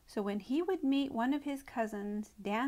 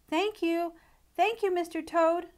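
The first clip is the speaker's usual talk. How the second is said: higher, louder hurried thanks; shouted thanks; 8 semitones higher, 6 dB louder than usual. A narrator's reading. Mouse shouting thanks to Mister Toad as he rushes off. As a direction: call it back over the shoulder. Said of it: "for he shouted".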